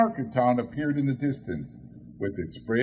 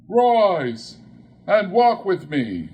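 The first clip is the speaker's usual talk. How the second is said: ghost command; 7 semitones higher, 8 dB louder, slower than usual. Acted.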